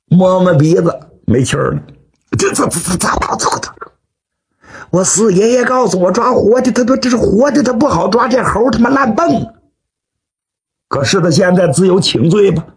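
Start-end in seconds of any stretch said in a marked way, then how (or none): 2.4-9.5 stammer, then blurt it out higher; high, flustered excuse tumbling out. Acted. Killer finding his captive dead, excusing himself in a flustered stammer.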